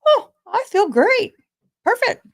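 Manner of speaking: high bright self-quote; perky high quoted thought; chipper inner voice. Retold or imitated. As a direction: play your morning self, cheery and high. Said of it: "and I thought".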